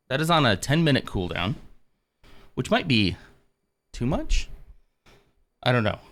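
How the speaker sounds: winded, catching breath mid-sentence; breathless from the workout. Real, not acted. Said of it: "I'm a little more out of breath than I thought".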